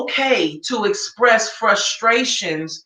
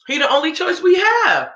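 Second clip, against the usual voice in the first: indignant; 6 semitones higher than usual. Real, not acted. Answering the commenter.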